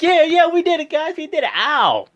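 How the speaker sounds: high, loud hammed-up cheering; mock celebration. Fan acting out Jason Jordan's over-eager celebration, hammed-up cheering. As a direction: cheer like a goofy rookie who just won. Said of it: "Jordan comes in and like".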